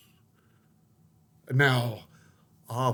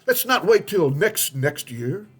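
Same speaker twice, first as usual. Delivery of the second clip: grand speech; 6 semitones higher, 5 dB louder than usual. Acted. Persian cat gives a speech.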